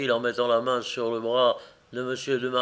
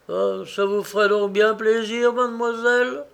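ordinary delivery

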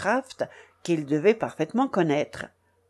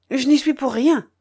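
higher, louder quick denial of blame; hasty disclaimer; 5 semitones higher, 5 dB louder than usual. A narrator's reading. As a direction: rush to clear yourself. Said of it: "m'empressai-je de déclarer".

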